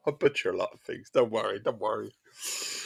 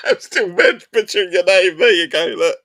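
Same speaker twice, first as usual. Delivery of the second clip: loud, high, through real laughter; laughing hard; 8 semitones higher, 8 dB louder than usual. Real, not acted. Streamer cracking up at butchering a viewer's name, laughing loudly. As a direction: crack up while talking, voice shooting up.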